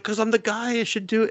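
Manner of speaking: high, put-on cocky voice; playful high smugness; smug boy. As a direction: state it as obvious. Boy claims his right.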